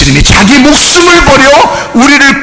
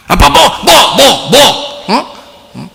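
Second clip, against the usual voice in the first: mock demanding kid, a little higher and pushy; bossy child ordering dad; 6 semitones higher than usual. Child ordering her dad around, mock demanding. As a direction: play the bratty child bossing her father. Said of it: "이 명령하고 막 그래요".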